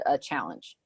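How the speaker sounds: ordinary delivery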